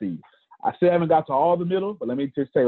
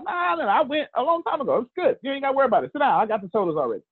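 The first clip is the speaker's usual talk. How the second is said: casual reassurance; 9 semitones higher, faster than usual. Acted. Tells Michelle casually, acted.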